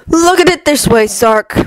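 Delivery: cold god Loki. Acted. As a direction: lay out the plan like a calm schemer. Loki voiced by reader.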